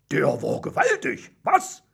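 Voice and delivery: boast, then fish for agreement; proud contestant. A narrator's reading. Werner shows off to the audience after his turn, proud.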